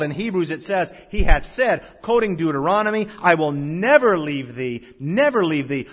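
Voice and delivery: preaching at full force. Acted. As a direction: proclaim it to the whole hall.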